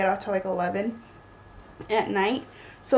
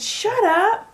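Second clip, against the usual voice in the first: high, loud squeal of disbelief; excited shout; 11 semitones higher, 5 dB louder than usual. Retold or imitated. Wife retelling her reply to the kneeling proposal, high excited shout. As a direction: shriek it in happy shock. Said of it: "I said".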